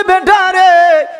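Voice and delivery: high, long-drawn motherly cry; mother calling her child. Acted. Mother cow answers her calf, high drawn-out call.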